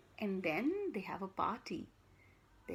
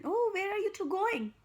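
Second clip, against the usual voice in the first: high, louder friendly lady voice; 10 semitones higher, 5 dB louder than usual. Acted. Storyteller voicing Miss Govlock greeting Ellen, high and bright. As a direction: sing it out as a cheery neighbour.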